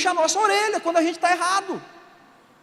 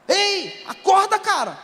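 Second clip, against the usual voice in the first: acted wake-up shout; 7 semitones higher, slower than usual. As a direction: shout to jolt someone to their senses. Waking up a desperate person. (grito encenado para acordar alguém)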